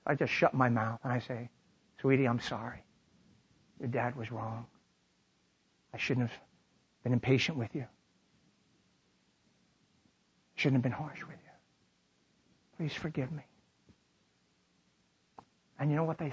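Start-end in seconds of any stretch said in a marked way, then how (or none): 2.0-13.4 very soft, low, halting apology; hushed, remorseful dad; tender apology. Retold or imitated. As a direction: humbly ask a child's forgiveness, almost under your breath. Father apologizes to his daughter.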